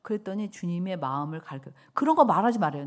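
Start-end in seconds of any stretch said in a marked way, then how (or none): 1.9-2.9 loud protest. Retold or imitated. Retold protest to the Lord.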